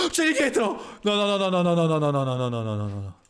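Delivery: startled and scrambling; gasping panic. Real, not acted. Zombie behind him.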